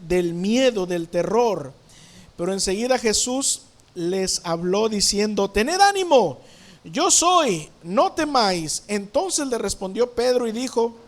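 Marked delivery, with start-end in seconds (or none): 5.5-8.8 raised, higher voice, easing off at the end; calling out encouragement. Acted. Preacher voices Jesus to frightened disciples, calling out reassurance. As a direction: proclaim it firmly to frightened listeners.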